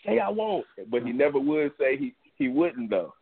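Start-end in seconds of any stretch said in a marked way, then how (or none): 0.0-0.7 hammed-up angry threat, pitched higher; mock angry threat. Retelling a boxer's taunt at Joshua, in a mock angry higher voice. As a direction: act out a mad boxer daring him. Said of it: "he did get mad".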